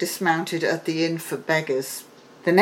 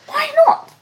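king; 7 dB louder than usual. A narrator's reading. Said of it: "said the king".

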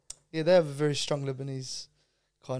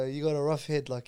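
ordinary talk

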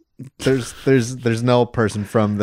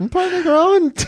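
squeaky-high, louder mock protest; 4 dB louder than usual. Podcaster defending himself to co-host, high-pitched mock protest. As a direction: push it way up high in joking defence. Repeats joking protest over the interruption.

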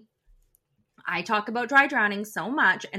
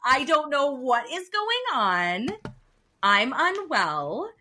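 slow mock-sick complaint; 3 semitones higher, slower than usual. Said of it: "I'm like".

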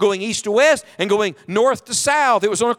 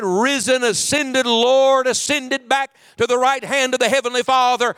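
usual speaking voice

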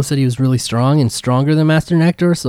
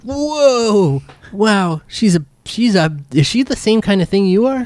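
surprised guard voice; 7 semitones higher than usual. Acted. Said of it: "and he's like".

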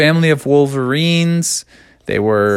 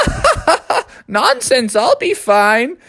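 put-on laughing old owl; 12 semitones higher, much slower than usual.

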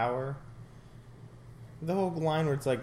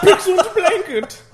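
high, loud, slow hammed-up German; hammy fake German cry, laughing; mock German shout; 16 dB louder than usual. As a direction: bellow it like a cartoon German pig. Mock German pig voice joke.

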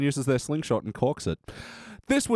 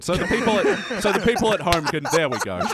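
talking through laughter; laughing; 3 semitones higher than usual. Real, not acted. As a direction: laugh while saying it. Laughing during high fives.

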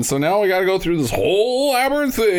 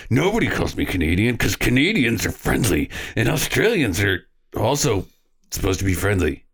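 mock offended tough guy; 7 semitones lower than usual. Acting offended Australian Preston.

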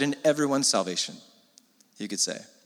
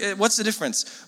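ordinary talk

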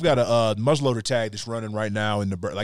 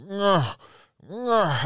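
moose grunt; 8 semitones higher than usual. Retold or imitated. Imitating another moose grunting.

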